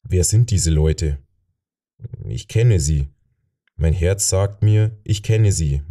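usual speaking voice

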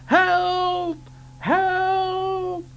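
high steady whistle call; whistled cry for help. Acted. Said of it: "whistling for help".

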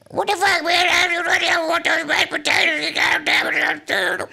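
very high shouting squeal; Obama phone lady. Retold or imitated. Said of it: "You sound like Obama phone lady".

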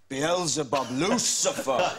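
mock-grand demon incantation, last name drawn out; summoning the devil. Acting out his rehearsal of summoning the devil.

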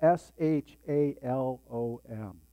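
"S H A L O M" spelled out slowly letter by letter. Shown as a demonstration. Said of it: "peace is spelled".

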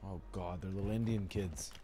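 muttered aside. Real, not acted. Low muttered reaction.

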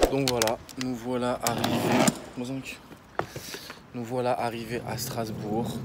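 quiet. Real, not acted. Arriving at night in hotel.

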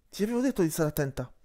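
panting. Acted. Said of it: "ansimò Azuen".

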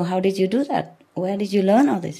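ordinary delivery

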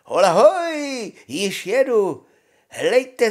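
high, loud, drawn-out halloo; calling out. A narrator's reading. Honza hails his brothers from behind, hollering high. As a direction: holler ahead for attention.

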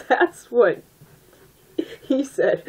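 high, giggly joke; real giggles breaking through; laughing. Real, not acted. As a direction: crack up while saying it. Innuendo joke while laughing.

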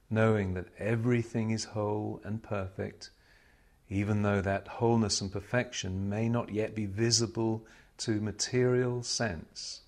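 ordinary talk

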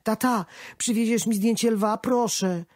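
put-on small boy begging his dad; little son. A narrator's reading. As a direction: son tugging at his father's trousers, pleading. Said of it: "synek szarpał mnie za nogawkę".

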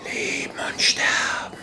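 breathy voiceless whisper from the shadows; a strange voice whispering from every corner; soft eerie whisper. A narrator's reading. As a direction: whisper it like a ghost hiding in the dark. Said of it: "Die fremde Stimme war sanft".

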